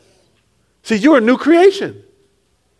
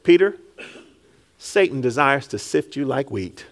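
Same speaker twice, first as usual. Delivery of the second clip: tender Jesus voice; 7 semitones lower, 8 dB quieter than usual. Acted. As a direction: lower and softer, like a quiet promise. Jesus speaking tenderly to Peter.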